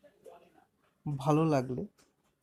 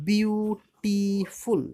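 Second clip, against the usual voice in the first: "Beautiful" very slow, loud and high, in three beats; split into syllables; 6 semitones higher, 6 dB louder, much slower than usual. Shown as a demonstration. Teacher showing a three-syllable word, "beautiful" slowly broken up. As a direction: stretch "beautiful" out as বিউ-টি-ফুল. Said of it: "তিনবার উচ্চারণ হয়".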